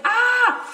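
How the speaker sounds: sharp high "Ah!" burst; a quick startled cry, loud and high; short panicked yelp. Shown as a demonstration. Showing friends her panicked little yell. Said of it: "It's not like a scream or yell, it's more like".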